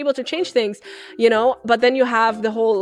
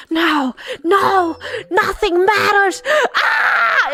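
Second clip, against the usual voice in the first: anguished scream; 8 semitones higher, much slower than usual. Acted. Sasuke mourning Sakura, anguished screaming. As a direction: break down over her death. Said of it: "And then he screams".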